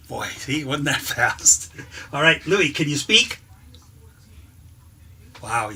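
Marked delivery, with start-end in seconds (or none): 2.1-3.4 loud dog command. Acted.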